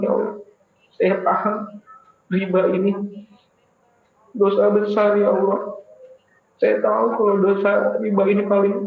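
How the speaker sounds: doa memelas, lebih tinggi dan keras; memohon sungguh-sungguh. Acted. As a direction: merayu Allah dengan suara tinggi yang memohon. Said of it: "minta banget sama Allah". (pendakwah memperagakan doa tobat, memelas)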